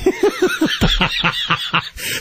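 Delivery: high burst of real laughter. Real, not acted. Host laughing at co-host's Croatia jab, high burst of laughter. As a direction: crack up at the joke.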